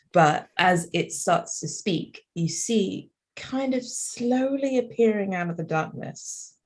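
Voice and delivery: ordinary talk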